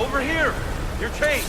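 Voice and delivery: high, loud call for attention. Acted. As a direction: shout so they can find you. Calling out during the rescue.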